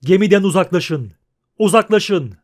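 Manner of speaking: loud, repeated command; shouting. A narrator's reading. Bandaged horseman clearing the jetty, shouting. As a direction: shout the crowd away. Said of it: "diye bağırıyordu".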